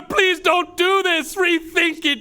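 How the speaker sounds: hammed-up plea for mercy; desperate pleading. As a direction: beg for your life, fading as you run out of ideas. Begging captors for his life.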